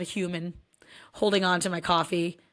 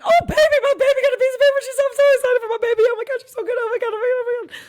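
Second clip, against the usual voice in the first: high, loud, fast gushing mom voice; 9 dB louder, faster than usual. Retold or imitated. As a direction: gush over her like an overjoyed mom. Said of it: "I'm like".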